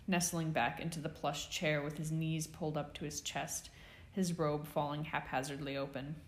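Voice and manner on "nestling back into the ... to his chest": plain reading voice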